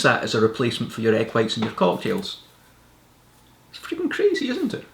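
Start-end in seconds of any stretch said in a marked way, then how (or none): 3.7-4.9 amazed. Real, not acted. Amazed at chickpea trick.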